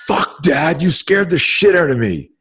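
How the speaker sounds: high, startled outburst at dad; mock-startled, high-pitched swearing; startled daughter. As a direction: imitate a daughter jolted by a scare. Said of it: "she fucking startle flinched".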